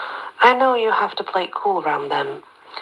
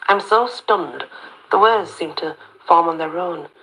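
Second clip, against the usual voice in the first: plain reading voice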